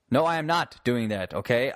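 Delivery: normal talking voice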